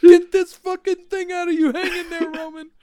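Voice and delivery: very high, squeaky put-on dog; imitated dog voice. Dog trying to rescue Roman from the tube, high imitated dog voice. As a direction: voice the dog in a falsetto. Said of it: "she's like".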